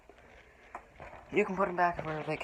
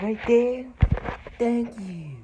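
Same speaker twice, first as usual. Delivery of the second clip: put-on much higher, louder cheery kids' show host; bright preschool TV thank-you, pitched way up; Dora the Explorer; 9 semitones higher, 10 dB louder, much slower than usual. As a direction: praise the viewer like a cartoon explorer. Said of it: "trying to do something like Dora the Explorer".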